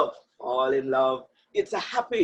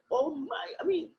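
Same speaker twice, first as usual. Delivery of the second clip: higher-pitched talking to oneself; acted inner voice, pitched up in dismay; honest self-reproach; 9 semitones higher, 3 dB quieter than usual. Speaker voices someone admitting a past wrong to themselves, honest self-reproach. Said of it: "be honest to yourself and say".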